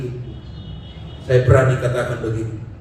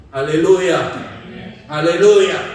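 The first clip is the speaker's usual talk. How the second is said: louder, lifted hallelujah, falling at the end; 3 semitones higher, 6 dB louder, slower than usual. Acted. Preacher rallying congregation, ringing hallelujah. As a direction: shout Haleluya to rally the room.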